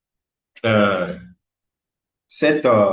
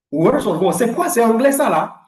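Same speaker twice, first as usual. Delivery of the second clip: imitated baffled student, pitched up; mock disbelief, louder and higher; incrédulité d'élève; 7 semitones higher, 5 dB louder than usual. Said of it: "J'ai dit, mais". (incrédule, imitant l'élève perplexe)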